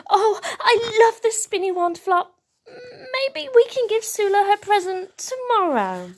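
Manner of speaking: put-on high, wistful child voice; pleading young character. A narrator's reading. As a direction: plead in a little high voice to hang on to it. Bing reluctant to give present away.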